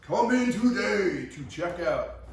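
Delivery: booming hyped ad announcer; monster truck ad. Pretend. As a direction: shout it like a monster truck rally promo. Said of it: "those monster truck commercials and they talk big".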